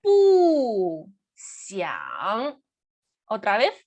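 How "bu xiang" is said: Bu falling hard, xiang dipping; fourth then third tone. Shown as a demonstration. Teacher keeping fourth tone, Bu falls, xiang dips. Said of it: "Mantenemos el cuarto tono".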